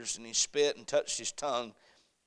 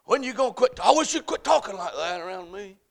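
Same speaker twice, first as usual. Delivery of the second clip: mock scolding; 7 semitones higher, 6 dB louder than usual.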